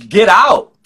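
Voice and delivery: sudden high loud push; emphatic get out. Real, not acted. Preacher urging dancers to quit, loud burst. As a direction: fire them out the door with it. Urging people to leave bad jobs.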